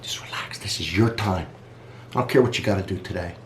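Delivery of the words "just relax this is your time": whisper. Real, not acted. Soothing sign-off to viewers.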